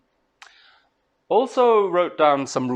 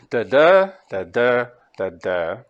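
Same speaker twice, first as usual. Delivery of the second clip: "da da" slowly as da DA, the second one long and louder; iambic stress; 2 semitones lower, 3 dB louder than usual. Shown as a demonstration. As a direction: beat out iambs, leaning on each second "da". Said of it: "And this is iambic".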